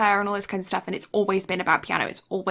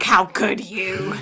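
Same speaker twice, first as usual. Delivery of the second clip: slow, drawn-out mock reproach; mock scold; 3 dB louder, much slower than usual. Little girls on the bridge scolding the boy, slow mock reproach. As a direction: drag it out in judgement.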